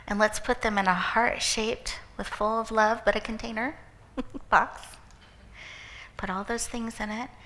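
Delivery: soft, calm voice leading a visualization, partly whispered; guided visualization. Acted. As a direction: gently guide the audience through the exercise.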